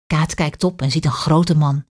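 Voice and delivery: ordinary delivery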